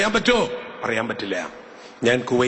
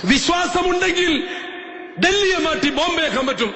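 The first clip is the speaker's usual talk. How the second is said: preaching at full force. Acted. Preacher proclaims faith to the congregation at full force. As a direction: proclaim it to the whole church.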